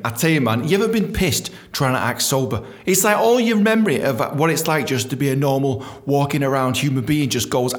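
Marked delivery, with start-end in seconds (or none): none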